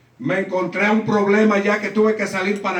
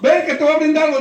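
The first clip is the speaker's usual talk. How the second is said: eager insistence; 8 semitones higher, 4 dB louder, faster than usual. Retold or imitated. Friends insist on treating him.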